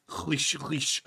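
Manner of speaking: swishing mop noise made with the mouth; mop sound. Acted. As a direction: imitate a mop swishing on the floor. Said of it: "that's the sound of a mop".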